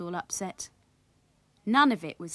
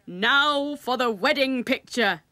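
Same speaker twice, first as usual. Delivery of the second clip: loud, slow, higher-pitched announcement; drawn-out hearty call; uncle crying out; 4 semitones higher, 6 dB louder, slower than usual. A narrator's reading. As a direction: announce it grandly to the whole party. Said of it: "cried Willie's Uncle Fred".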